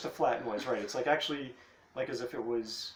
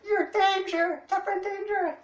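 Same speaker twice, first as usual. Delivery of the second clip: very high, loud calling over the waves; female sea cat calling; 6 dB louder than usual. Acted. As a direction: shriek for Catherine from far out at sea. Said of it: "the other one next to him is like".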